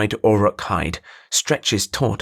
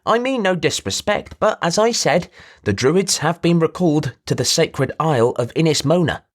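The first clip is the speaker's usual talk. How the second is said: nasal, higher-pitched merchant voice; a showy trader pinching his voice through the nose; nasally voice; 7 semitones higher than usual. Acted. Merchant introduces himself to the druid, nasal and higher.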